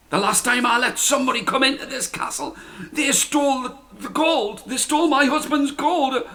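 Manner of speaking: giant's wife. A narrator's reading. Giant's wife telling Jack about the stolen gold. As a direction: refuse him, aggrieved.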